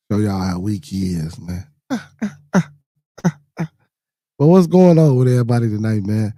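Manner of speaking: ordinary delivery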